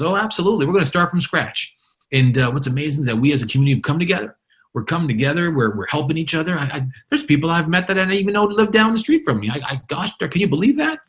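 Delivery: high, rushing imitated enthusiasm; all excited. Storm survivor praising his community to reporter, all excited. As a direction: gush like an amazed, upbeat survivor. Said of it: "He's all excited about what's going on".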